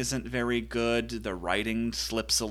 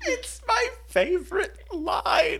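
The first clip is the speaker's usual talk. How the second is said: high, laughing through the words; helpless laughter breaking the line; real giggling, high; 9 dB louder than usual. Real, not acted. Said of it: "It's so".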